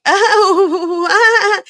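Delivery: loud, high, drawn-out laughing wail; hammed-up cry wavering between laughter and sobs; mock tearful wail. Imitating the bride talking about her mom passing, mock laugh-cry wail. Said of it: "She was smiling and then frowning".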